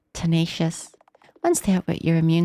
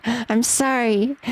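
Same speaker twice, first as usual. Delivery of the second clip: slow, higher I'm sorry, drawn out; slow repeated apology; 5 semitones higher, much slower than usual. Acted. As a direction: stretch the apology slowly. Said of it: "Now repeat it".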